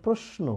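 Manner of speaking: প্রশ্ন with a clear s before the n; dental S demo. Shown as a demonstration. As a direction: say the শ in প্রশ্ন as s, not sh. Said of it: "তালবস্য কেন দন্ত স হলো". (প্রশ্ন-এর শ দন্ত স-এর মতো উচ্চারণ)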